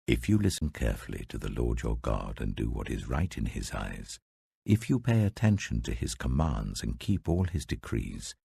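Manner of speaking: voice of the Lord. A narrator's reading. The Lord's words in Exodus reading.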